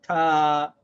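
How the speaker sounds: Ta said loud and long; letter name Ta. Shown as a demonstration. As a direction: call out Ta clearly. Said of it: "This is called".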